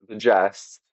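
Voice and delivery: "digest" as di-JEST; second-syllable stress. Shown as a demonstration. Host showing the English verb, stress on second syllable. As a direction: hit the last syllable of "digest". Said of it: "second syllable".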